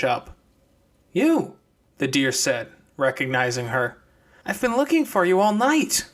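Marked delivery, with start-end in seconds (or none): 1.1-1.6 high, sharp "You"; surprised recognition. Acted. Deer recognizes Clementine. 4.5-6.1 put-on high young girl's voice; deer girl's voice. Deer speaks to Clementine.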